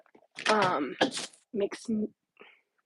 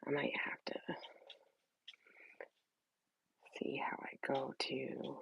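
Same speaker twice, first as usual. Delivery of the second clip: half-voiced whisper to herself; whispered aside; 6 semitones lower, 18 dB quieter than usual. Real, not acted. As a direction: mutter it under your breath while fiddling. Muttering to herself while setting up.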